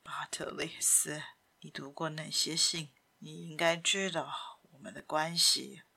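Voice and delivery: hushed, low and half-whispered; low murmur. A narrator's reading. Simon pleading with his son, in a pained murmur. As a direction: talk softly to yourself, voice sunk low. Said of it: "而是低声地说着".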